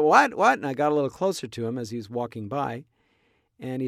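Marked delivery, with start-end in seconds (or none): 0.0-0.6 calling back. Retold or imitated. Calling back across street.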